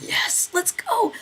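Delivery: high, loud, slow drawn-out yes; eager cheer. Retold or imitated. Urging friends into the cave, drawn-out excited cheer.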